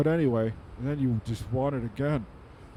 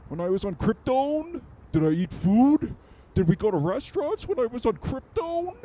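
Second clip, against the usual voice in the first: rising disbelief; 11 semitones higher than usual. Acted. Comic as Superman wondering about food on Krypton, climbing higher. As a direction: pitch climbing higher with each question.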